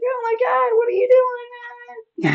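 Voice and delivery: very high mock wail; hammed-up distressed whine, dragging out the end; imitated screaming. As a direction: mimic an exasperated neighbor wailing in falsetto. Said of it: "screaming out her window".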